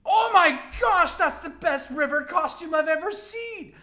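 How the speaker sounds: high, loud, squealing fan gushing. Acted. Cosplayer gushing at River over her costume, squealing excitedly.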